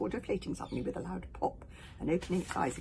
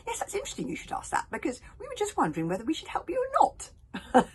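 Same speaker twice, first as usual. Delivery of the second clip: high, quick, breezy chatter; bright teasing girl; chatty girl voice; 13 semitones higher, 6 dB louder than usual. Acted. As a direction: tease him cheerfully. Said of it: "explained Kamikaze".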